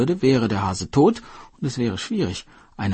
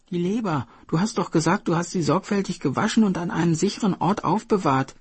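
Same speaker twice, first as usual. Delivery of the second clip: a hint of the earnest turtle, higher; 11 semitones higher than usual. Turtle reminding the hare to fetch his liver, earnest. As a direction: remind him of his promise.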